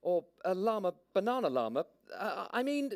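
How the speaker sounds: put-on high, flustered soothing girl; placating girl voice. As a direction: soothe the llama nervously. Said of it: "llama-mollifying tone of voice".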